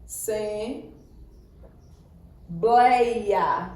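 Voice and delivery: "Assembleia" very slowly, long gaps between syllables; syllable separation. Shown as a demonstration. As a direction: break "Assembleia" apart: a... sem... bleia. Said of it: "Vejamos aqui". ("Assembleia" separada em sílabas, bem devagar)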